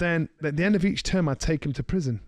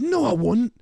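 higher, louder flat denial; defiant kid; 8 semitones higher, 4 dB louder than usual. Retold or imitated. Kid refusing the prisoner, sharp raised denial. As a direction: push back hard like a cocky teenager.

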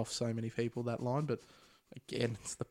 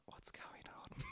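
whispered; 19 dB quieter, slower than usual. Retold or imitated. Imitating girlfriend in cinema.

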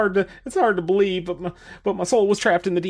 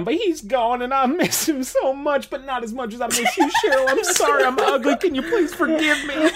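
put-on southern accent; 7 semitones higher than usual. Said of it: "I'm not sure why I have a southern accent now".

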